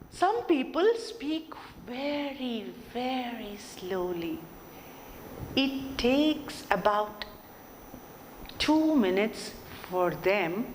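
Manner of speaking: slow, dragged-out words with long pauses; very slow speech. Shown as a demonstration. Teacher showing students how very slow speaking sounds. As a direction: stretch every phrase out painfully. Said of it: "When I slowed down".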